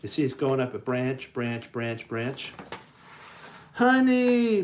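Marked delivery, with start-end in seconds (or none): 3.7-4.6 high, loud joyful cry; excited honey. Acted. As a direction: shout it as he reaches the top. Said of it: "Pooh declared".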